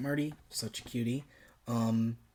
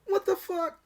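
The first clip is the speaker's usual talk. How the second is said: shrieked high, mock-stunned outburst; mock shock. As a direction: yelp it in hammed-up disbelief. Dan seeing cat attack Herbert.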